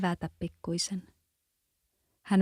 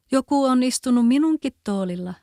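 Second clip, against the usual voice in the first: higher, louder little bear cub; 5 semitones higher, 7 dB louder than usual. A narrator's reading. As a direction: squeak it out as the tiny bear. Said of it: "hänen pikkuäänellä". (pienen karhunpennun korkea ääni)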